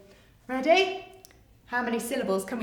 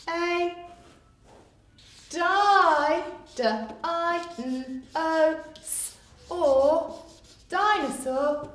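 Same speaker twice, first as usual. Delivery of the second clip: sounding out; 4 semitones higher, much slower than usual. Shown as a demonstration. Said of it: "sounding out spelling".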